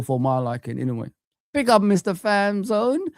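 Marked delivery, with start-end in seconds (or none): none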